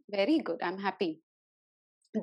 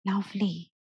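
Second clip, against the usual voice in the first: guided breathing; 3 dB louder than usual. Acted. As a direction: lead them gently through breaths. Leads breathing exercise.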